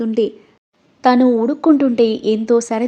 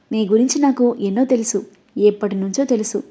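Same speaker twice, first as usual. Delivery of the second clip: usual speaking voice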